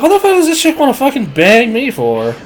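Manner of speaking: high, incredulous question; can't believe your luck. Retold or imitated. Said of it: "so I'm like".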